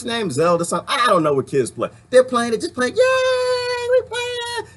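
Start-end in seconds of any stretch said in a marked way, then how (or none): 2.9-4.7 high squeaky mock child cheer; mock kid cheering. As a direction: play a giddy kid gamer. Mock kids cheering at video game.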